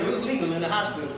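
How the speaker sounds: ordinary delivery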